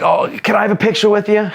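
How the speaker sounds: put-on high, excited kid asking for a photo; starstruck kid voice. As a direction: gush like an eager young fan. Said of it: "every kid in there was just like".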